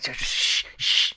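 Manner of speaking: exaggerated long shush; tipsy shushing. Lake reacting to a secret, overdone drunk-style shushing. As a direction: hush like someone too buzzed to be discreet. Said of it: "when you tell drunk people to be quiet".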